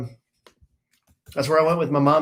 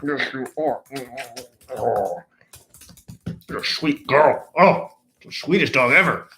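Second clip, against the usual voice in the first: high, sweet pet-talk voice; doggy talk; 3 semitones higher than usual. Real, not acted. Dog owner cooing at her sweet dogs. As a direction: coo over the dogs.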